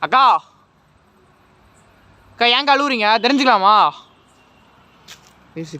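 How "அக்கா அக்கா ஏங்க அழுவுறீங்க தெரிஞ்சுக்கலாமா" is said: high, loud plea to a crying spirit; calling out. Real, not acted. Ghost hunter asks the crying sister why she weeps, loud. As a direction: call to her and ask why she cries.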